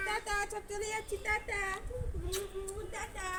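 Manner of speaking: high, soft bye-bye. Real, not acted. Waving bye to baby, high soft.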